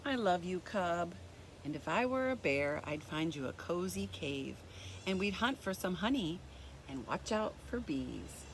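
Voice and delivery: gentle, even storybook reading; reading to children. A narrator's reading. Teacher reading a picture book to kids, gentle storybook voice.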